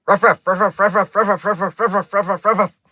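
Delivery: rattling off "rough" at a high, loud pitch; tongue twister fast. Shown as a demonstration. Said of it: "impossible to say rough, rough, fast".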